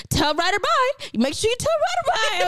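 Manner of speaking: high, sing-song pointed goodbye; pointed mom voice. Retold or imitated. Other mom pointedly says bye to Ryder, high and sing-song. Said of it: "She goes".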